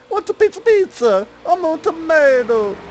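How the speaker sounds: badly faked Italian accent, very high. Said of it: "try to be an Italian".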